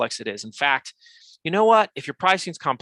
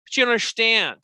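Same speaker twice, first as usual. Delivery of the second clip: much higher, louder whiny objection; mock protest; 10 semitones higher, 6 dB louder, much slower than usual. Business owners objecting to the host, mock loud protest. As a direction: burst out like a defensive business owner. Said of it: "people hear that and they're like".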